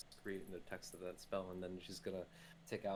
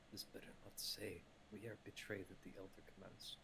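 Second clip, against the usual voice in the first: muttered under her breath; 9 dB quieter than usual. Acted. Kat grumbles over the note she asked Seven to read, muttering. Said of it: "she kinda mutters in Zdarl under her breath".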